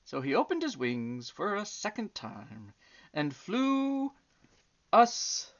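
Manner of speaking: put-on gentle storybook reading for children; story narration. A narrator's reading. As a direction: read it like a bedtime tale. Reads a bedtime story aloud.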